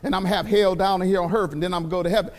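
lightly mocking the hell-on-earth crowd; mock complainer. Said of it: "people talking about".

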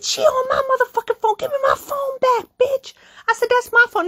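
very high-pitched yelling in a fight; reenacted furious screech. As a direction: screech for your property back. Retold fight over phone.

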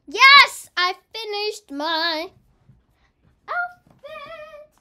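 very loud, high drawn-out cheer; triumphant screaming. Real, not acted. Child gamer finishing the outfit in time, screaming. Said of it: "Sorry if I'm screaming".

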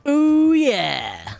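high, loud whooping ooh; excited whoop. Real, not acted. Gamer hyping his epic final stand, loud high whoop. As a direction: let out a big hyped whoop. Hyped before going down.

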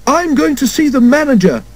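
high-pitched, indignant threat; acted anger in a high voice; shouting mother. A narrator's reading. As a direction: complain like an outraged mother. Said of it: "shouted his mother".

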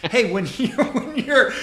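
laughing. Real, not acted. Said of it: "because I was like".

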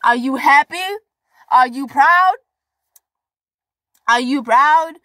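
high, loud questions to future self; loud, high, excited questions to your future self; excited calling out. Real, not acted.

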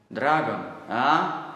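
contemptuous drawn-out question; slow sneering question. Acted. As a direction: belittle the boy's toy. SS man sneers at toy.

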